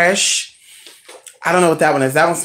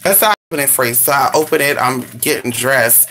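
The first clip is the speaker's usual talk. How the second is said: normal talking voice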